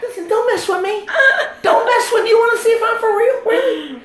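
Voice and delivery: very high-pitched, comic challenge; mock tough talk. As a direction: squeal out playful threats. Mock tough talk about big brother.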